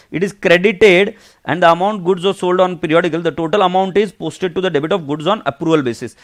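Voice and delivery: reading the textbook line aloud, slow and measured. Acted. Teacher reading the accounts rule to students, slow and measured. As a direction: spell it out slowly from the book.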